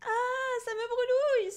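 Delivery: very high, loud wail; high-pitched cry of comic agony; writhing. A narrator's reading. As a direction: yelp as if being scalded. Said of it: "se tordit-il en tout sautant".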